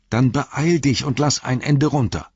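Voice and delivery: high, urgent call; drängendes Rufen. A narrator's reading. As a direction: call up and hurry your friend. Frodo calls up urgently. (hoch und drängend gerufen)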